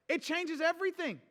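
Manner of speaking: usual speaking voice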